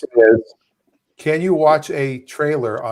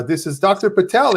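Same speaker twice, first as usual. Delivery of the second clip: lightly put-on Indian accent, mock doctor; a light hacky Indian accent on the phone; 6 semitones higher than usual. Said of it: "the hacky Indian accent".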